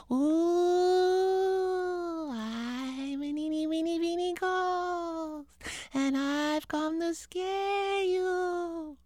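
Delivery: high, soft, slow ghostly moan; spooky ghost voice. Acted.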